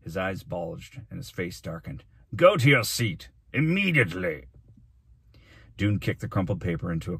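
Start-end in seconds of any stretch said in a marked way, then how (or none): 2.4-4.5 loud, raised scolding command; angry order. Acted. Mayor sending the boy to his seat, angry order. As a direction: order him off in outrage.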